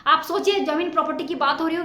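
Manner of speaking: ordinary delivery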